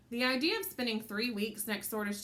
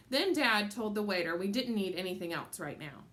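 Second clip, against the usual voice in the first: ordinary delivery, nothing marked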